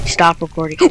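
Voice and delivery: usual speaking voice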